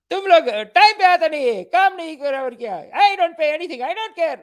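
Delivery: high, loud mock tirade in Hindi and English; hammed-up high-pitched yelling; yelling mom. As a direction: imitate a mom berating the cleaners. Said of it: "she's yelling at them".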